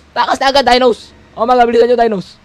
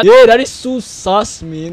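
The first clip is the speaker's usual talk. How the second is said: mock suspicion; slower than usual. Teasing Among Us reference.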